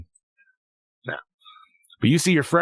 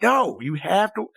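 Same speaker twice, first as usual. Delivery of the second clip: slow, higher, insistent no; 8 semitones higher, slower than usual. Retold or imitated. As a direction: insist on it like a tired dad. Insisting to daughter.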